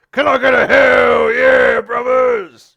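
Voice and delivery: booming slow showman's roar to the crowd; wrestler hype shout. Acted. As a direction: work the crowd like a pro wrestler with a megaphone. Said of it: "Well, go on then".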